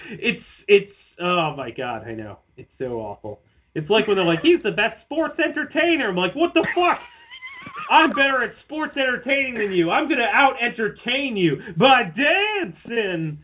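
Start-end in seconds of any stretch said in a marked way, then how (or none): 4.4-6.1 high-pitched mocking hype. Imitating promo talk about sports entertainers, mock hype. 6.3-7.0 high incredulous outburst; exasperated disbelief. Retold or imitated. Fan reacting to sports entertainer talk, exasperated disbelief. 7.9-13.4 high, hammed-up challenge; mock boast. Mock wrestler challenging a rival to entertain, boastful.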